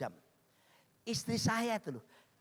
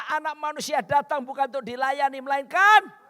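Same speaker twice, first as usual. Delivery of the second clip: high, loud preaching; scripture declamation; 6 semitones higher, 9 dB louder than usual. Real, not acted. Preacher quotes Jesus to congregation, forceful declamation. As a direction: proclaim it, rising to a loud last word.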